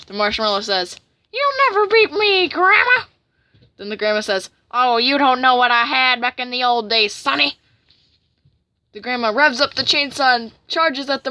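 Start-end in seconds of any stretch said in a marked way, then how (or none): 1.3-3.0 very high, loud challenge; hammed-up battle taunt; defiant cartoon villain. Villain defies grandma in duel. 4.7-7.6 loud put-on granny voice; feisty old granny. Old granny character retorts.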